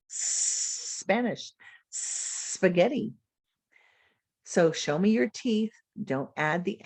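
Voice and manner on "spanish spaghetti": Spanish said without a leading e, starting on the s; no extra vowel. Shown as a demonstration. Teacher showing the s blend without an added vowel. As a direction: start straight on the hissed s.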